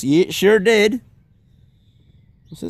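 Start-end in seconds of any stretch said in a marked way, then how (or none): 0.0-1.0 put-on slow, higher Texan accent; slow proud Texan accent. Imitating Chuck.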